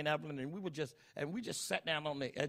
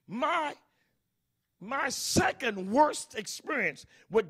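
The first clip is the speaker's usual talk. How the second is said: loud, high, shouted sermon at full force; preacher exhorting grandmothers to pray for the children, hammering each line; fiery preaching; 10 semitones higher, 12 dB louder than usual. Acted.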